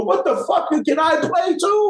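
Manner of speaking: high-pitched imitated yelp; indignant eager outburst. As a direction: burst out begging to join in, voice way up. Acting Duff's indignant, eager outburst.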